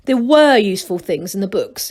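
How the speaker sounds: ordinary delivery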